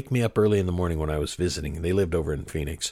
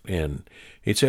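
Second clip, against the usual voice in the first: ordinary talk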